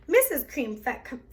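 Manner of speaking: ordinary delivery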